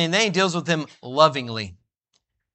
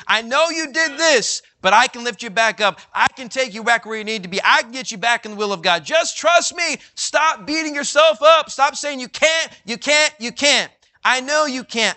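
high, loud preaching cry; fervent urging; 8 semitones higher, 3 dB louder than usual. Pretend. Jesus lifting up a failing believer, loud and high. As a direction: lift them up with passion. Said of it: "reminds you".

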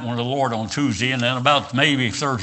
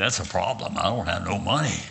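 low, soft, deflated retelling; mock resigned admission; 5 semitones lower, 6 dB quieter than usual. Preacher replying about his broken car, quietly resigned. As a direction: drop down low and quiet, admitting defeat.

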